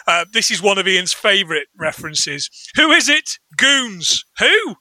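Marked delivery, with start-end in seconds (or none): 2.7-4.2 higher voice calling through a door; acted doorway exchange. 4.4-4.7 high-pitched questioning shout-back; mock puzzled query. Acting out the puzzled householder.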